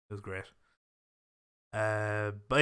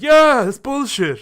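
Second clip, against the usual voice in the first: much higher and louder, dragged out slowly; mock fan outrage; 10 semitones higher, 10 dB louder, slower than usual. Online fans dismissing the book theory with a slow, loud, high scoff. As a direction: bellow it like an annoyed fan. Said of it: "people would be like".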